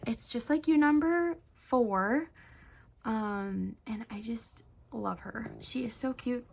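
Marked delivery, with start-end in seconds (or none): 3.9-6.5 breathy gushing whisper; soft adoring whisper. Real, not acted. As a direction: whisper it like a secret love. Gushing softly over doll.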